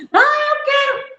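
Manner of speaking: put-on high, slow child's whine; whiny toddler. Teacher imitating a child demanding a toy, high whiny voice. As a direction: whine like a little kid wanting a toy.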